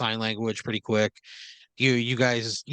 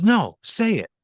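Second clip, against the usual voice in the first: higher, louder insistence; confrontational demand; 9 semitones higher, 7 dB louder, slower than usual. Acted. Boss demanding that the worker speak up, confrontational. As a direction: push for an answer, raised voice.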